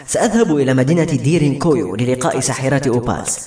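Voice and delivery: usual speaking voice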